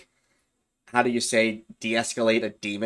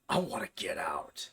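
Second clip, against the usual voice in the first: lower, much softer eerie demon voice; 4 semitones lower, 9 dB quieter than usual. Retold or imitated. Demon answering what it wants, in a low soft eerie voice. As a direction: breathe it like a trapped thing.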